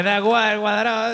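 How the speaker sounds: high, loud shouted retort; excited shout. Retold or imitated. Friend retelling his reply, shouted high and loud. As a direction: blurt it out loud.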